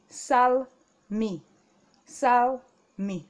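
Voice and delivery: sal mi read out clearly; Korean reading. Shown as a demonstration. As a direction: model sal mi for the class. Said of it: "this will be".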